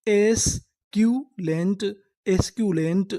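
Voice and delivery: very slow, rising; slow teaching model; slow syllable-by-syllable reading. Shown as a demonstration. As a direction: sound out एस्क्यूलेंट piece by piece. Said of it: "पूरी meaning क्या बनी?".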